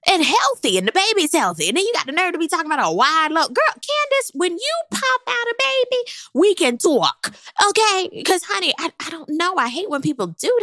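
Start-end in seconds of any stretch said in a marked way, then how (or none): 3.5-6.0 high, teasing callout; sassy callout. Acted. Host calling out Candace, high and sassy. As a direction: tease her from way up high.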